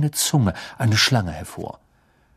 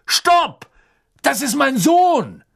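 desperate father shouting; 12 semitones higher, 4 dB louder, slower than usual. A narrator's reading. Father stops attack on son.

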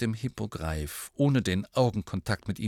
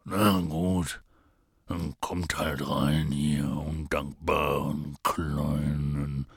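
very slow, low grumble; gloomy muttering giant; 8 semitones lower, much slower than usual. A narrator's reading. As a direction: grumble darkly to yourself, dragging it out. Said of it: "Düster vor sich hin murmelnd".

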